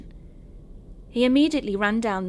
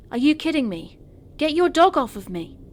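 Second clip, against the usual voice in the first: high, loud outraged shout; indignant exclamation; 8 semitones higher, 7 dB louder than usual. A narrator's reading. Attacked walker to laughing dog owner, indignant exclamation. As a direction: exclaim it, pitch and volume jumping up. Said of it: "Again I exclaimed".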